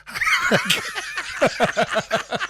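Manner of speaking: high, loud shrieking laugh; helpless laughter. Real, not acted. Storyteller breaking up at her own story, shrieking laughter. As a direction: crack up uncontrollably.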